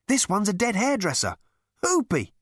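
high, excited cry of discovery; gleeful announcement. A narrator's reading. Ford announcing his find to his friend, gleefully excited.

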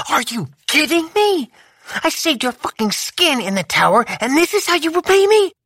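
high, disbelieving outrage; outraged. A narrator's reading. Lou to Boz, high, outraged. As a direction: throw the betrayal back at him.

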